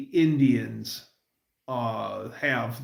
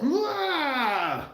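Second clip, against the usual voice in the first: high manic laugh burst; crazy cackle; 13 semitones higher than usual. Retold or imitated. Fan imitating the crazy pilot's manic laugh. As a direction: let out a loony high laugh.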